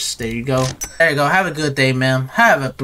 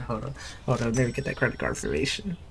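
much softer, lower and quick; under the breath; 5 semitones lower, 14 dB quieter, faster than usual. Real, not acted. Cashier asking customer for credit card information, under the breath. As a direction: say it almost to yourself.

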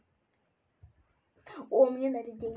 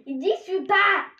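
loud high call, voice raised; yelling across the room; 6 semitones higher, 5 dB louder than usual. Real, not acted. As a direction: shout it to someone far off. Sister calling brother over.

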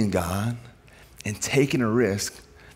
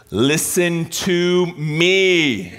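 drawn-out, higher-pitched parent talk; loud and slow; 4 semitones higher, much slower than usual. Retold or imitated. Dad sitting his kids down to listen, loud and slow. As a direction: stretch every word out for kids who won't listen. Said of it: "talk loud and slow".

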